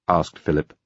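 usual speaking voice

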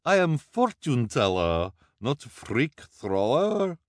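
high, put-on voice, slowing at the end; protesting fortune teller. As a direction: protest in character, drawing out the last words. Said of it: "protested, looking at the children nervously".

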